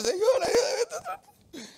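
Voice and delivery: very high, drawn-out wailing cry for help; hammed-up whimper of someone falling to pieces; mock desperate plea. Pastor acts broken believer begging God, mock wail. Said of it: "todo hecho pedazos".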